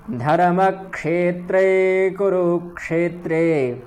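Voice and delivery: क्षेत्रे with distinct क्ष, slow; clear ksha. Shown as a demonstration. Teacher reciting the verse to show the क्ष. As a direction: separate क and श in क्षेत्रे. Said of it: "इसका उच्चारण क्ष".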